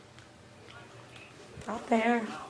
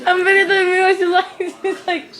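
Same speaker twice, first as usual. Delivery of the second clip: laughing; 6 semitones higher than usual. Real, not acted. Laughing at grandma.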